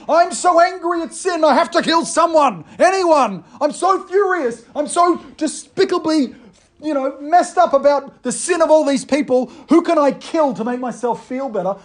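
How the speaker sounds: high, loud, hammed-up rage of a vengeful god; mock furious God. Preacher mocking a wrong image of God, hammed-up furious. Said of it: "it's not God saying".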